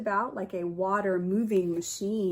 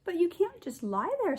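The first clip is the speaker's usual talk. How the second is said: higher, urging fish voice; Swimmy; 10 semitones higher than usual. A narrator's reading. Teacher voicing Swimmy urging the red fish, higher. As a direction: coax the others like a brave little fish. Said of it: "said Swimmy".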